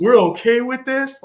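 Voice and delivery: loud, high incredulous question; high mock-outraged cry; mock disbelief. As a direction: imitate a comic's incredulous outburst. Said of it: "he's just like".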